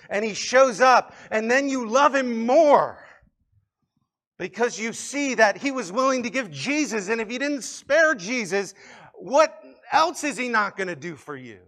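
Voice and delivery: high, driving sermon voice, often loud; impassioned preaching. Real, not acted. Preacher urging listeners to rely on God, impassioned. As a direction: build with fervor and punch the key words.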